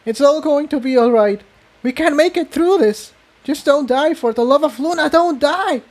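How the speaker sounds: high, desperate tearful pleading; a high voice pleading, fighting back tears; pleading through tears. A narrator's reading. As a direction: beg her friend not to die. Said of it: "Tiptoe said through her tears".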